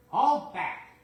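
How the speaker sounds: high, loud giggling mischief; naughty cars giggling together. Acted. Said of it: "giggled the freight cars".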